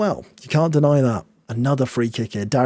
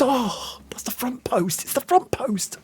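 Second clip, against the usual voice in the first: high exasperation; 12 semitones higher, 3 dB quieter, slower than usual. Real, not acted.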